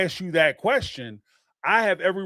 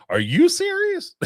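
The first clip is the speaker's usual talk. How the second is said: mock incredulous; 5 dB louder than usual. Mocking the repeated question.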